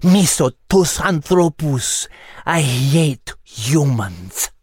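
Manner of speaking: put-on slow, venomous Greek-accented cat; spitting hatred. A narrator's reading. Kakia tells Liz her hatred of humans, spat out slowly. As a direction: spit out each word with slow contempt. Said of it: "She spat".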